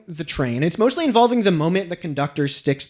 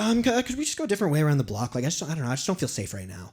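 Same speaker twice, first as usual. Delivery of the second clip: mildly uneasy, softer and hesitant; uncomfortable friend; 4 semitones lower, 8 dB quieter, faster than usual. Retold or imitated. Visiting friend asks to go another way, imitated uneasiness. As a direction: imitate a nervous friend, trailing lower and quieter. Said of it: "he got so uncomfortable".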